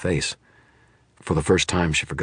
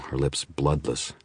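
ordinary delivery, nothing marked

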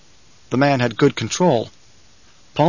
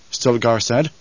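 ordinary delivery, nothing marked

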